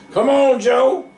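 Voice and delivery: high, loud yell. A narrator's reading. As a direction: yell at the mules to pull. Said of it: "he yelled".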